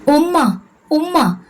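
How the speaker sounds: high, pleading call to mother. Acted. Daughter locked out calls to her mother, high pleading call. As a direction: cry out for her mother through the gate. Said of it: "Aneesa വിളിച്ചു".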